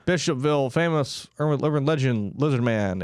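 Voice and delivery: ordinary talk, nothing marked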